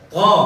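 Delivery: heavy emphatic To from the throat; teacher models heavy To; model syllable to. Shown as a demonstration. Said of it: "pangkal lidah naik".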